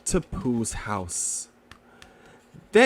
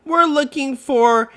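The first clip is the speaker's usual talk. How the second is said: high, louder, eager little kangaroo; excited little Roo; 9 semitones higher, 6 dB louder than usual. Acted. Roo tells Piglet their quest, excited and high. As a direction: announce it like a thrilled child. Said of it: "announced Roo excitedly".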